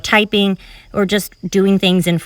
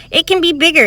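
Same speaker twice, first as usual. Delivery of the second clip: bigger, higher voice; 8 semitones higher than usual. Shown as a demonstration. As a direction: lift the voice to sell bigger. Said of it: "It doesn't have to be as high or as jumpy".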